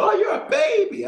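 a sudden high, delighted exclamation; high old lady voice. Retold or imitated. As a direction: squeal it up high like an excited older lady. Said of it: "and she said".

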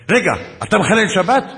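high, loud incredulous question; shocked challenge. Retold or imitated. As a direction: imitate a sergeant suddenly catching on. Sergeant's shocked challenge, retold.

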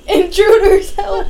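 high, loud panicked call; mock cry for help. Frat boy calling for help against the intruder, loud mock cry.

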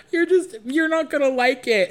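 high, loud tearful whine; hammed-up sobbing protest; mock crying. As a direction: imitate a drunk guy wailing. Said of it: "starts crying".

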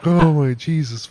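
high, very loud outburst; loud exclamation. Real, not acted. Reacting to a friend's possessed act, loud and high. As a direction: cry it out, overcome by the joke.

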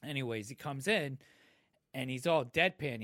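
ordinary talk

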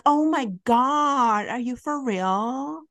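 slow, drawn-out fake amazement; mock disbelief. To the yelling neighbor, mock slow disbelief. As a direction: play dumb with slow exaggerated shock. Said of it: "me being me".

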